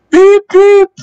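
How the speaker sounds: high piercing engine whistle beeps. Acted. Edward calling his late passengers with a high whistle. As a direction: beep like a steam engine whistle. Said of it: "whistled Edward".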